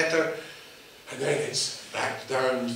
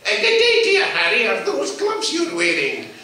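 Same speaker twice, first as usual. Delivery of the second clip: put-on high, loud old crone greeting; old woman voice; 13 semitones higher, 6 dB louder than usual. Old woman calling to Harry over her garden gate, high and loud.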